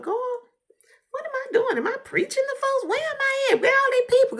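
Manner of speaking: high, loud, anxious plea to God; pleading with God. Retold or imitated. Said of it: "I used to tell God".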